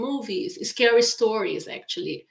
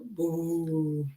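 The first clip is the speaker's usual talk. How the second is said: creepy quiet boo; 4 semitones lower, 6 dB quieter than usual. Shown as a demonstration. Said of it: "you should be a little scared".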